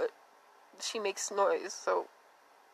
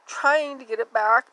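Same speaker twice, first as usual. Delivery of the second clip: normal talking voice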